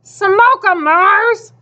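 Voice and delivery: high rooting cheer; called out. A narrator's reading. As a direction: cheer your runner on from the crowd. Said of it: "someone else called".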